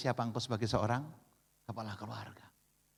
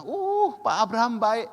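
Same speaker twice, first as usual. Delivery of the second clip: flattering congregant; 8 semitones higher, 7 dB louder, slower than usual. Retold or imitated. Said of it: "Yang kedua bilang".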